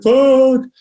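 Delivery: sudden high, loud curse as the board slips; startled curse. Retold or imitated. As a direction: blurt it out like you feel the board go. Board slips while dropping in.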